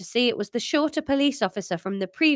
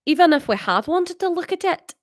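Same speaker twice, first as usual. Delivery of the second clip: high-pitched Scottish read; higher Scottish character voice; Scottish accent; 8 semitones higher, 4 dB louder than usual. Acted. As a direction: read it in an Edinburgh-style high voice. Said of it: "is that Scottish?".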